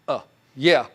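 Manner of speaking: imitating a doubtful customer's reluctant uh yeah; a half-hearted, unconvinced agreement; hesitant yeah. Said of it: "As soon as I hear the".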